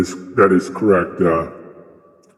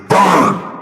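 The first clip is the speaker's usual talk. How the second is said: high, drawn-out mock roar; sudden hellish outburst; 10 semitones higher than usual. God interrupting the philosopher's talk, roaring doom. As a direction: break out in a raised cry of doom.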